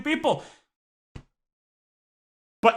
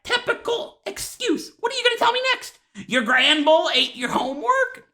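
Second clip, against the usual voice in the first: much higher, scoffing teacher; mocking teacher voice; 9 semitones higher than usual. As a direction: sneer it like a fed-up teacher. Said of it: "And she's like".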